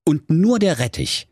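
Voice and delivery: ordinary delivery, nothing marked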